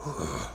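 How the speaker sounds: gorilla grunt. Retold or imitated. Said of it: "It's like a damn gorilla".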